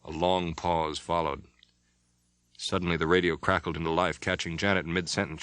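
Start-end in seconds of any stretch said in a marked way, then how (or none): none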